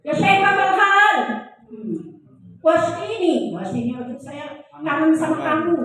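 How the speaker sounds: loud, high-pitched effusive greeting. Acted. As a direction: greet a long-lost relative at high volume. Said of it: "intonasinya meninggi".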